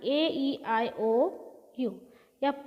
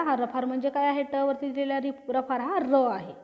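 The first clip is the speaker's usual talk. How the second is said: ordinary delivery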